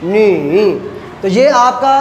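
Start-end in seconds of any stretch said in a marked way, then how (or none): 0.0-0.6 नी dipped low then rising; teacher demo of नी; third tone. Shown as a demonstration. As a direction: dip नी and lift it. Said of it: "ली".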